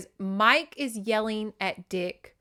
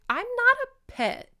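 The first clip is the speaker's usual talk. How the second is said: much higher, indignant mock protest; 3 dB louder than usual. Meg objecting to being treated like a child, acted indignation. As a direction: push the pitch way up in affronted complaint.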